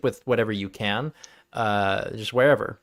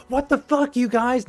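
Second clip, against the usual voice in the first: high incredulous outburst; mock outrage pitched high; outraged players; 11 semitones higher than usual. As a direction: demand answers, scandalised. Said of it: "you're like".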